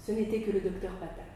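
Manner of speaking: ordinary delivery, nothing marked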